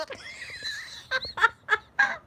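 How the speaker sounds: very high giggling fit; real high-pitched laugh; helpless laughter. Real, not acted. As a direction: crack up at your own story. Laughing at her own story.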